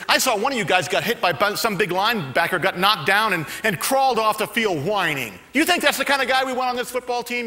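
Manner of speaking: loud, high mocking rant; scornful coach. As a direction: sneer at the whiner. Coach berates rookies.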